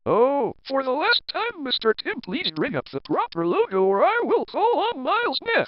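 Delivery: rising exasperation, last chance before calling the boss; exasperated warning. Acted.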